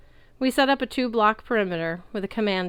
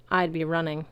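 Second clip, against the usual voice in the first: plain reading voice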